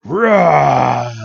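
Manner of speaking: loud bellowing roar; monster roar. Acted. Machoke charging after Red, loud monster roar. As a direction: let out a beast's roar as it charges.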